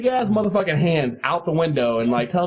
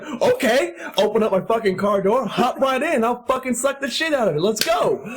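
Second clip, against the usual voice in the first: high, eager goading; hammed-up challenge; 7 semitones higher than usual. Driver invites the heckler into his car, over-eager challenge. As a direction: call his bluff, breaking into a laugh at the end. Retold calling of the bluff.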